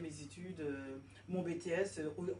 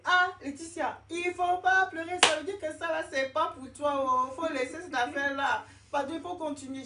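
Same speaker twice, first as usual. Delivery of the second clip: high, loud, insistent mum voice; imitating her mother; 9 semitones higher, 9 dB louder than usual. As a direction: act out a mother urging her daughter on, much higher and louder. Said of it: "ma mère me disait". (imitation de sa mère, voix haute et forte)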